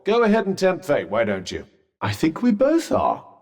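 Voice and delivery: ordinary talk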